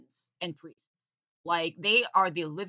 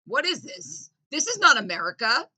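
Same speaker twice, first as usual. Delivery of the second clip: mock outrage; 6 semitones higher, 7 dB louder than usual.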